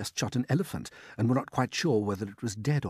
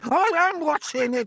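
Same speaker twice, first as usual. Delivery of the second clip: a much higher, louder put-on aunt's voice, excited; Aunt Sponge; 7 dB louder than usual. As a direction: play the greedy aunt gaping at the peach.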